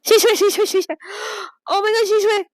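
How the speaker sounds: high, anguished calling of Xishui; grief-stricken, near tears; real distress. Real, not acted. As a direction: cry out her name in disbelief. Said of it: "am I gonna cry".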